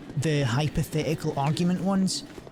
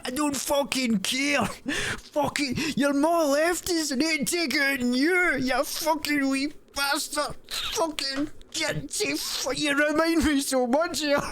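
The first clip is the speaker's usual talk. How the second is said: very high strained rant; mock ranting dad; 11 semitones higher than usual. Dad ranting at the kid, high cartoon voice.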